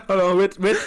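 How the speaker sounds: normal talking voice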